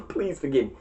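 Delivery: ordinary talk, nothing marked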